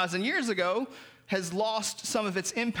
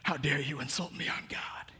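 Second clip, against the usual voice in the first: offended God; 6 semitones lower, 4 dB quieter than usual. Retold or imitated. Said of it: "because he's like".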